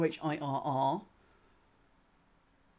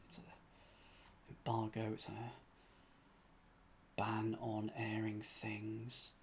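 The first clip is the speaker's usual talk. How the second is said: whisper; 6 semitones lower, 14 dB quieter than usual. Real, not acted. Whispering definition to self.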